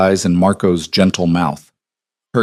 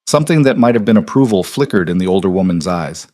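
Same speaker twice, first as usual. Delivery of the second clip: ordinary delivery, nothing marked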